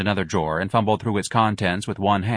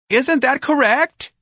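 much higher, louder frantic scream; screaming manager; 5 dB louder, slower than usual. A narrator's reading. Office manager screaming at the old clerk. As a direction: scream it in desperation.

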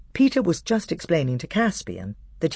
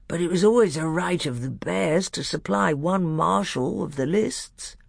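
drowsy bear, a little higher; thick, sleepy voice; 5 semitones higher than usual. A narrator's reading. Bear claiming his right to be marshal, sleepy. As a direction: plod through it sleepily. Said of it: "sleepy voice said".

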